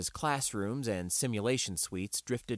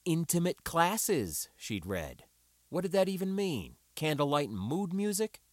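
sarcastic musing; 7 semitones higher than usual. A narrator's reading. Sarcastic inner thoughts.

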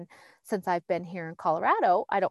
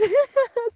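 high-pitched laugh; bright burst of high laughter; 5 dB louder than usual. Real, not acted. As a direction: laugh at your own impression. Laughs at own acted amazement.

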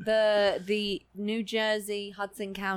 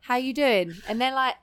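chirpy phone caller; 6 semitones higher, 4 dB louder, faster than usual. Retold or imitated. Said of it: "if you just be like".

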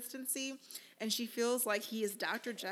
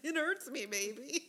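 laughing through a hammed-up It hurts me baby; mock the tearful plea with a laugh; mocking tearful wife; 4 semitones higher, 3 dB louder than usual. Host laughing as she mimics the crying wife. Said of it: "It felt a little performative".

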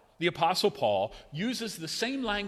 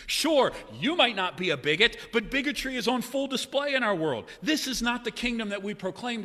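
raised, louder preaching voice; preacher pushing the congregation; fervent preaching; 9 semitones higher, 5 dB louder than usual. Real, not acted. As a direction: build the challenge with conviction. Preaching with rising force.

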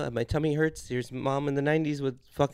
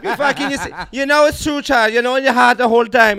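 much higher, loud, laughing mock Jamaican psychic; laughing Miss Cleo; 9 semitones higher, 12 dB louder than usual. Psychic insisting to the caller, loud laughing mock accent. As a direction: push the psychic's verdict through laughter. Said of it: "it's like".

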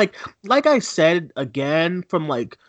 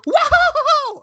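very high, loud cheesy hero yell; over-the-top cheer; mock hero whoop; 8 dB louder than usual. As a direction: ham up Aquaman's cry. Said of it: "he goes like".

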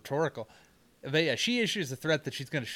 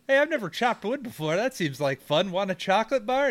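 cheerful Joey; 6 semitones higher than usual. Retold or imitated. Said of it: "is like".

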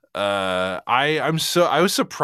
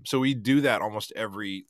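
ordinary delivery

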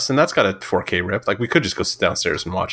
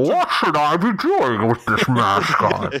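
slow, higher mock Bane voice; Bane impression; 5 semitones higher, slower than usual. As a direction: do a childish Bane through a mask. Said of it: "I'm doing three-year-old Bane impressions".

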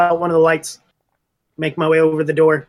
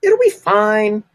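higher, drawn-out breezy reassurance; lightly voiced carefree Melkor; 4 semitones higher, much slower than usual. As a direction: brush the worry off slowly.

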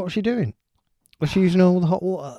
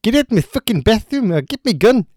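a bad Geordie accent that rises into a loud ending; mock Geordie, climbing louder on the last line; a hammed-up Geordie accent; awful Geordie accent; 4 dB louder than usual. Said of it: "was it a Geordie Oscar Pistorius?".

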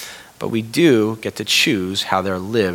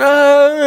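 high loud mock wail of a crying toddler; imitated toddler wail; 8 dB louder than usual. Father imitating his crying daughter, loud mock wail. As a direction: cry out like a two-year-old in a skunk costume.

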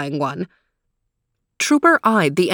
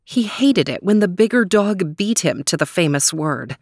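ordinary delivery

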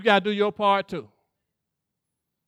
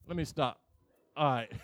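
ordinary talk, nothing marked

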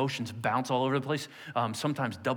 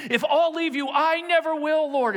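high, laughing, loud Peter vowing loyalty; eager vow; 11 semitones higher, 5 dB louder than usual. Retold or imitated. Peter swearing to Jesus he'll never leave, laughing and loud. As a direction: burst out with a laughing promise.

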